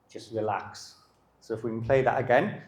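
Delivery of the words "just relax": calm relax. Shown as a demonstration. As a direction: breathe it out to calm the dog. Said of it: "I don't bite him back".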